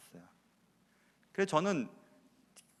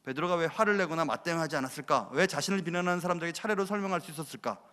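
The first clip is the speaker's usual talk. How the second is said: ordinary delivery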